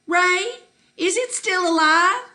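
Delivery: high, loud call to someone outside. A narrator's reading. Ma calls out to her husband, high loud call. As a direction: call out anxiously, pitched well up. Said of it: "and then she calls out".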